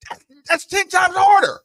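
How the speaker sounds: high, loud, exasperated outburst; son's changed tone. Retold or imitated. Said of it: "his whole tone changed".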